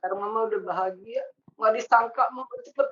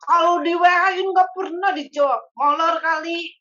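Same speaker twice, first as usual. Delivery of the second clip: louder, higher exasperated complaint; mock scolding; 8 semitones higher, 7 dB louder than usual. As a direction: grumble loudly like an impatient mom. Mama complaining about Teti.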